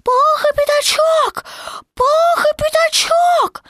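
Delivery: very high, loud, drawn-out squeal; anxious squeak. A narrator's reading. Someone up the branch calls two friends, anxious squeak. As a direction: squeak the names out slowly from afar. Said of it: "донёсся чей-то встревоженный писк".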